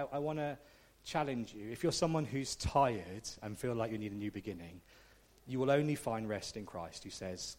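ordinary talk

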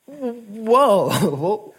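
high, drawn-out amazed whoa; stunned reaction, replayed; astonished. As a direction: act out being taken aback, pitch way up. Retold amazement at the friend's words.

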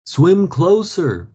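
slow, high and loud; man on the bank shouting to Frank. A narrator's reading. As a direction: call him in, drawn out. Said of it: "shouted the man".

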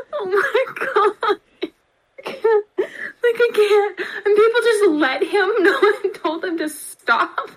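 real laughter breaking through words; helpless giggles while talking; laughing. Real, not acted. Cracking up at absurd story.

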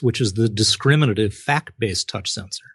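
ordinary talk, nothing marked

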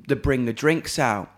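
ordinary talk, nothing marked

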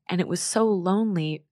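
normal talking voice

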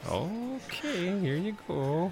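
high, drawn-out mom voice; wary mom. Acted. Mom serving the strange guest another slice, wary. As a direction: hand it over in a high, hesitant lilt.

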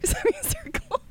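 high, breaking into giggles mid-reading; laughing. Real, not acted. Cracking up at her own southern reading, laughing. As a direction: laugh through the word.

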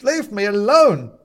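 high, loud desperate yell; terrified scream; panicked shout. A narrator's reading. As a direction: shout it in terror. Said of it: "shouts Benedict Nail".

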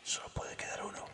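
susurro sin voz, grave y solemne; susurro ASMR épico. Pretend. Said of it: "haz A S M R modo Dios". (susurro ASMR "modo Dios", solemne)

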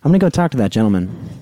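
ordinary talk

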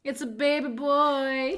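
slow, loud, high game-show announcement; mock announcer. Friend playing announcer at the gender reveal, slow and loud. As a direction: boom it out like a reveal host.

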